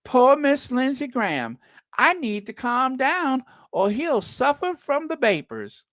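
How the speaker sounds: high, drawling Southern belle voice. Pretend. Mocking the senator as a fainting Southern belle. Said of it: "that's Southern Belle".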